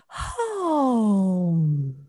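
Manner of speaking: gentle sustained hum; warm-up hum. Shown as a demonstration. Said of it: "just little hums".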